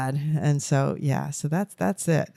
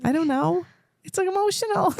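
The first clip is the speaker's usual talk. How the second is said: high, teary, barely holding it together; fighting back tears; choked up. Real, not acted. Grandma answering her co-host's question while welling up, choked up and teary. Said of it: "I'm crying too much".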